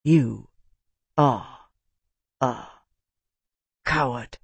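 word by word, long pauses; quiet, deliberate insult; soft and distinct. A narrator's reading. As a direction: set each word apart, calmly and softly. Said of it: "very soft and distinctly".

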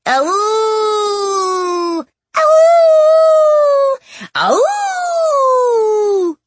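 high loud musical wolf howl. Acted. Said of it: "the Lumleys joined in too".

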